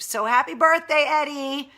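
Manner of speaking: bright, loud birthday wish; cheerful birthday shout. Real, not acted. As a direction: call it out like a toast. Birthday wishes to a friend.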